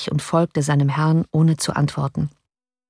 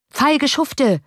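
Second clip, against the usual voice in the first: called out; 8 semitones higher than usual. A narrator's reading. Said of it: "rief der Leutnant".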